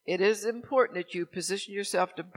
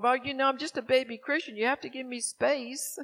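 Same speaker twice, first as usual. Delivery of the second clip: higher-pitched imitation of a pleading friend; friend making excuses; 6 semitones higher than usual. Best friend excusing herself as a new believer, imitated plea. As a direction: plead innocence in a raised, mock-helpless voice. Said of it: "Every time she do something wrong".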